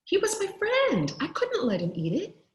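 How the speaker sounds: usual speaking voice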